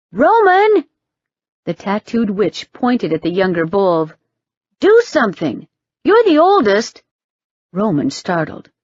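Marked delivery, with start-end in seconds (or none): 0.1-0.8 sharp high shout of a name; calling out. Acted. Witch calling on her brother for help, high shout. 4.8-7.0 urgent demand. Acted. Witch pleading loudly with brother.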